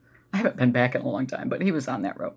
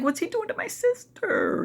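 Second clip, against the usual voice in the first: put-on much higher, slow, frightened child's plea; childlike panicked cry. Recalling her sister in danger, high frightened plea. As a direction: cry out like a terrified eight-year-old. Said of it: "I was like".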